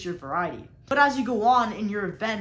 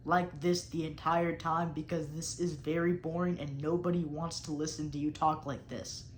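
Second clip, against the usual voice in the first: monotone demo; 5 semitones lower, 5 dB quieter than usual. Shown as a demonstration. Coach demonstrating the boring voice students should avoid, monotone. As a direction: lower and softer, a dull monotone drone. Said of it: "I, for an example".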